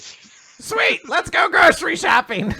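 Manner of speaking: high, loud, gleeful character yell; mock excited shout. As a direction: shout it out as an eager cartoon figure. Co-host jumps in acting a character.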